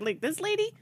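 high mocking quote; mocking imitation. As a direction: parrot the question in a high voice. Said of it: "When you were like".

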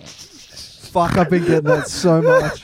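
loud, high, laughing delight; barely getting it out through laughter; cracking up. Real, not acted. Co-host thrilled by the friend's accent, cracking up.

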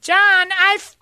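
very high, loud shrill calling; screaming waitress. Retold or imitated. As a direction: screech for help from across the room. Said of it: "She was the one that always screamed".

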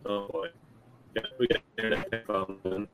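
choppy, garbled bad connection; radio breaking up. Real, not acted. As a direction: voice cutting in and out. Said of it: "You're breaking up real bad again".

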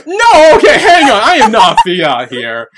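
high, loud laughing protest; laughing denial. Real, not acted.

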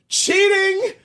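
high hammed-up cry of cheating; mock accusation. Jilted poster accusing ex, in a high mock cry. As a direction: yelp it high like a jilted lover.

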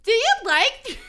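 high, loud, gushing tease; excited friend. Acted. Friend teasing about Draco, excited and high. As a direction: squeal it like a valley girl.

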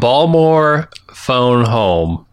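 co-host trying out long round Baltimore O's; Baltimore accent. Shown as a demonstration. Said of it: "Would you like to try a Baltimore accent".

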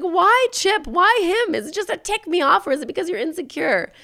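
high, loud pleading demand; mock anguished confrontation. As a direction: wail the questions at your rival. Voices Needy's anguished confrontation.

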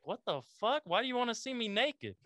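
high, baffled outburst; stunned and weirded out; shocked disbelief. Retold or imitated. Retold shock at stranger's request.

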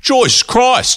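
high exaggerated Aussie twang on Joyce Christ; broad Australian accent. Shown as a demonstration. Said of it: "if you say it in a really Australian accent".

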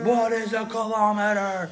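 high, slow, drawn-out cry; hammed-up patriotic bewilderment; mock clueless American. Camper echoes friend's America joke, mock high slow cry.

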